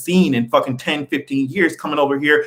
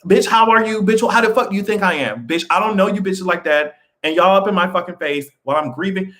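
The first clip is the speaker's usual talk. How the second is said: imagined comeback to a nosy relative, indignant; mock angry retort; 5 semitones higher than usual. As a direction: snap back at a nosy relative. Snapping back at nosy relatives.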